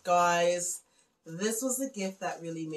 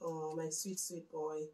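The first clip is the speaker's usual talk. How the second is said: softer, soft and loving; tender murmur; 3 semitones lower, 9 dB quieter than usual. Real, not acted. Mother speaking fondly of her son's gift, softly. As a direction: hush it fondly to her absent son.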